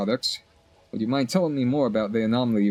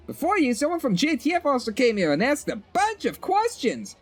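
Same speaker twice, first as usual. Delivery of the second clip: put-on much higher perky shop girl voice; chirpy shopkeeper; 13 semitones higher than usual. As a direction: voice the cheerful vendor up high.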